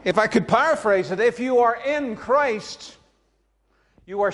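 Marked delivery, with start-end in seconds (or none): none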